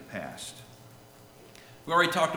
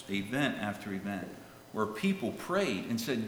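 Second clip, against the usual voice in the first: ordinary talk, nothing marked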